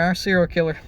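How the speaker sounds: usual speaking voice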